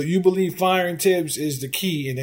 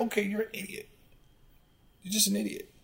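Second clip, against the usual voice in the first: soft, higher-pitched dismissal; quietly write off the commenter, pitched up; dismissive aside; 4 semitones higher, 11 dB quieter, much faster than usual. Acted. Host reacting to an unmarked sarcastic comment, quietly dismissive.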